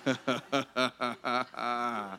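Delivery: low, quiet run of chuckles; soft delighted laugh. Real, not acted. Preacher finishing a healing testimony, soft delighted laughter.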